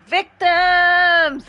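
loud, high playful holler; playful call-out. Pretend. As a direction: call out to the victims. Calling out as match starts.

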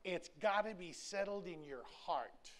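normal talking voice

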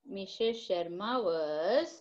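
very slow and soft, rising on was; slow dictation. Shown as a demonstration. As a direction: coax the answer out slowly. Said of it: "लिख के फिर answer लिखोगे".

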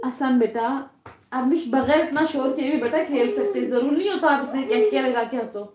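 a mother's quiet lecture from across the room. Real, not acted. Mother scolds laughing kids off-mic.

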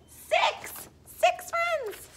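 put-on playful squeak for a young audience; squeaky count. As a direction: pop the last number up high. Counting, squeaky last number.

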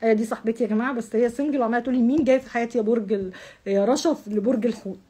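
usual speaking voice

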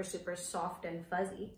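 ordinary talk, nothing marked

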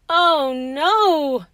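drawn-out acted wail of despair; moaning. A narrator's reading. George has lost his last tooth, moaning. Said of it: "George moaned".

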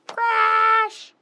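high, loud crash; playful loud crash. Acted. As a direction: shout crash as the cradle falls. Cradle falls in song.

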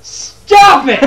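very high, loud yell breaking into laughter; exasperated yell. Real, not acted. Gamer to co-host making puns, laughing exasperated yell. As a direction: shout down the bad pun, laughing.

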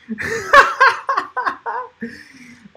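loud high burst of laughter; real laugh. Real, not acted. Reading a viewer's Alexa comment, he bursts into loud laughter. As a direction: crack up at the comment. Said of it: "That's freaking hilarious".

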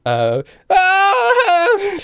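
sudden high loud scream. Acted. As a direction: let out a cry. Screams from effigy.

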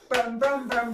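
ordinary talk, nothing marked

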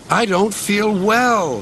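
high, slow sickly groan. A narrator's reading. Sir Handel feigns illness to skip work, groaning. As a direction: drag it out as if poorly. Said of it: "he groaned".

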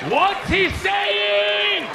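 very high, slow shout; loud, drawn-out, exaggerated yelling; yelled demand. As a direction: holler each word, stretched out long. Same question, now yelled.

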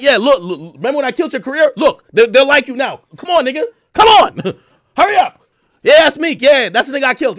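loud, high-pitched showing off; mock gloating. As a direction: parade your beaten rival, egging him on. Acting [name] gloating, showing off [name].

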